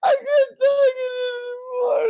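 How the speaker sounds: very high wailing shout; hysterical breakdown. Acted. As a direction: scream it out at breaking point. Man breaks down under threat.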